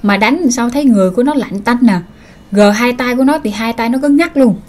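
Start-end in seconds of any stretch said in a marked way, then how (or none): none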